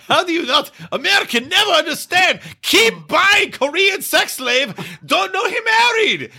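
put-on Korean accent. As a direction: shout it in broken English. Acted Korean lawyer voice.

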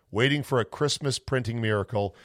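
ordinary talk

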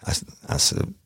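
ordinary delivery, nothing marked

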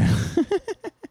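bursts of high laughter. Real, not acted. Laughing at Alex Jones joke.

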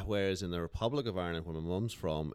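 ordinary talk

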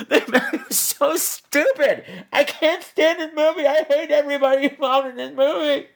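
high-pitched whining rant; exasperated. Real, not acted. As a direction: whine it in despair. Ranting in exasperation at the film.

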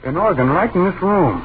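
spooked cry at the sound; alarmed. Acted. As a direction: blurt it out in fright. Ghost organ suddenly heard.

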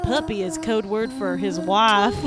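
lightly put-on Southern drawl; folksy Southern country gal; Southern accent. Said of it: "closing thoughts in a Southern accent".

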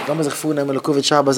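normal talking voice

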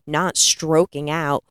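usual speaking voice

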